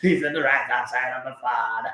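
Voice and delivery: drawn-out mock preacher cadence; preacher impression. Host parodying a church pastor preaching, in drawn-out mock-solemn cadence. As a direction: stretch the words like a pastor in the pulpit. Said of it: "why the church pastor the preacher".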